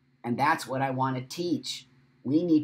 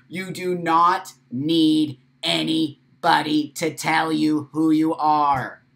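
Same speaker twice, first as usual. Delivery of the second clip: loud, slow, every word hammered; impassioned shout; 3 semitones higher, 9 dB louder, slower than usual. Real, not acted. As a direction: declare it like a rallying cry. Impassioned message to viewers.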